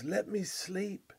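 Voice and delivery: soft, slow, drowsy begging; sleepy plea. Retold or imitated. Freezing Arctic traveller begging his companions, soft slow drowsy plea. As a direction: plead drowsily to be left to lie down. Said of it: "but still he cries".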